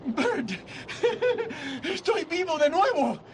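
high, tearful joyful laugh through the words. Acted. George telling Bert he's alive again, laughing through tears. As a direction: laugh and cry at once, overwhelmed.

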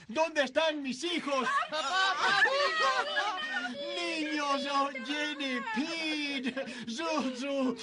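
high laughing cry; father laughing as he looks for his kids; joyous laughter. Acted. George calling joyfully for children.

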